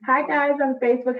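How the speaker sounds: usual speaking voice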